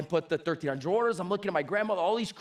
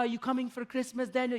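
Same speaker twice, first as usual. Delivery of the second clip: higher voice, lightly imitating nonna; a hint of an old Italian grandma on the phone; Italian grandmother; 5 semitones higher, 3 dB quieter than usual. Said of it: "I called her this morning".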